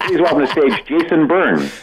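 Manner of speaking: high, laughing announcer's call; mock host announcement. Retelling Joan Rivers' botched intro, a laughing announcer call. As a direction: announce the wrong name with a grin. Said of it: "the introduction was".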